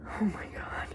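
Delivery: whispered oh my God; embarrassed whisper. Real, not acted. Reacting to embarrassing entry.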